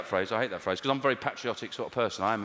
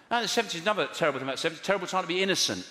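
normal talking voice

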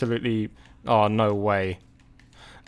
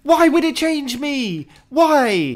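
exasperated outburst; 11 semitones higher, 5 dB louder, slower than usual. Real, not acted. Furious at game mechanics.

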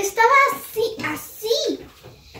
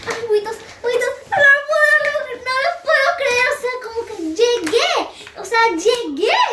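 disbelieving excitement; 6 semitones higher than usual. Real, not acted. Can't believe she made it.

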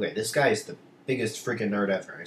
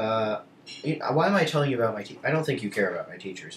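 ordinary delivery

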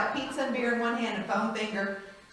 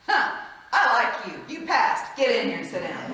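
laughing approval; 4 semitones higher, 8 dB louder than usual. Retold or imitated. Patient approves of the chaplain.

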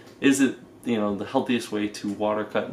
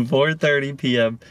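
ordinary talk

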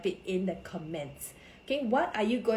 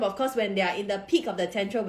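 ordinary delivery

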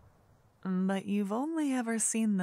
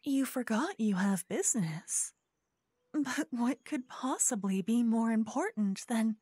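sweet scheming hostess; 7 semitones higher than usual. Acted. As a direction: coax the guest to stay, sugar over suspicion. Host character speaks to guest.

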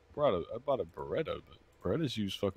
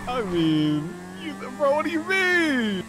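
high, loud and disbelieving; incredulous; 14 semitones higher, 8 dB louder, slower than usual. Real, not acted. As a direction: rise up in disbelief, slowly.

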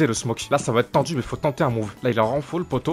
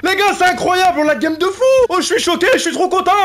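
very high, loud ecstatic shouting; yelling with pure elation; real victory scream; 8 dB louder than usual. Real, not acted. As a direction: scream with joy at the win. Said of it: "Désolé, si je crie vraiment".

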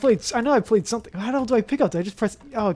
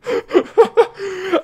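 high, helpless laughter; laugh after a scare; 9 semitones higher than usual. Real, not acted. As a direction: burst out laughing after the fright.

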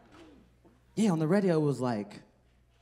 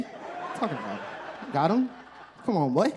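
ordinary delivery

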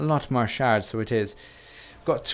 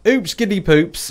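chirpy British exclamation; 6 semitones higher, 6 dB louder, slower than usual. Retold or imitated. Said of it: "positive sayings".

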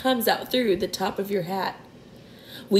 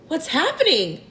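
higher-pitched startled question; alarmed cry; 7 semitones higher than usual. A narrator's reading. James leaping from his hammock, startled cry. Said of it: "cried James".

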